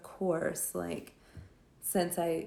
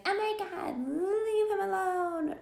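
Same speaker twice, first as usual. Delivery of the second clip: much higher, slow, airy mock girl voice; mock girly plea; 12 semitones higher than usual. Misa telling the bully to stop, high mocking girly voice. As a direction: parody a dramatic movie heroine.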